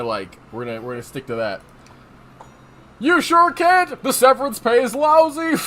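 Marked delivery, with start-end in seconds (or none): 3.0-5.6 big jokey delivery, close to laughing; jovial conductor. Acted. Conductor ribbing the new employee, jovial. Jolly conductor answers the rookie.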